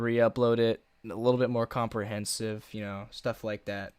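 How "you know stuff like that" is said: normal talking voice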